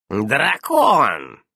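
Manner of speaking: loud, drawn-out disbelief; incredulous exclamation. A narrator's reading. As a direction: exclaim ДРАКОН as if unable to believe it. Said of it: "недоверчиво воскликнула ведьма".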